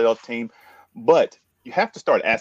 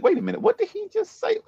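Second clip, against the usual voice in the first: surprised. Retold or imitated. Amazed listener quote.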